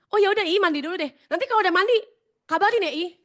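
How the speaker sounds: fast, loud, high eager chatter; bright pushy goodbye; eager chirpy reply. Retold or imitated. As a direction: rush him off cheerfully. She eagerly answers her leader.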